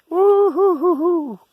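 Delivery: long, high falsetto whoop. Real, not acted. Wading into swampland, a slow high whoop.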